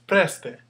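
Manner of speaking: "Preste" with a high, loud first E; "Preste", punched opening syllable, falling ending; stress on first syllable. Shown as a demonstration. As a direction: hit "Pres" hard in "Preste". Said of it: "The first E".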